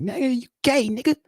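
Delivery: mock New York callout; New York mock jab. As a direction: toss it off like a New Yorker roasting you. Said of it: "My New York people were like".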